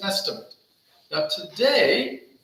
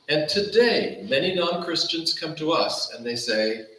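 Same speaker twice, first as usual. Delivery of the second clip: ordinary talk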